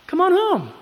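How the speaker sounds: high, loud pleading call; ringing altar call. Acted. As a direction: call the lost back from far away. Calls sinners back to God.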